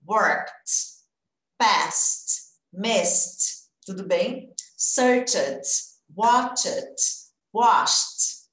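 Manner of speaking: worked, passed, missed, searched, watched, washed each ending on T; T sound ending. Shown as a demonstration. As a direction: drill the list ending on a crisp T. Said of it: "eu acrescento o som do T".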